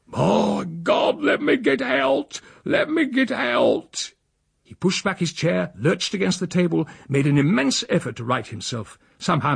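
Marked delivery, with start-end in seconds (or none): 0.1-4.1 high, slow strangled cry; choking panic. A narrator's reading. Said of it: "as though he were choking".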